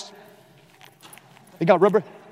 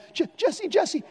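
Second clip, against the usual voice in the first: high, quiet, stammering Jesse; scared stammer; 9 semitones higher, 6 dB quieter, slower than usual. Retold or imitated. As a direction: stutter the name in fear. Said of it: "He says".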